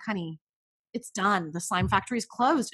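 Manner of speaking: soft, low, final verdict; quiet firm mom. Retold or imitated. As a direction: close the factory calmly and for good. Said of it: "I'm like".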